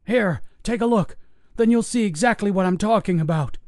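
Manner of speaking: put-on high, desperate old man shouting; frantic old guard. Old guard pleading with investigators who won't listen, desperate. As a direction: plead hard, near panic, pitched high. Pat voiced.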